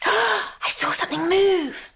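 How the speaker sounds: high breathless whisper; gasped whisper. A narrator's reading. Smallest guest notices the jelly, gasping whisper. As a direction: hush it out in awe. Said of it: "gasped the smallest guest".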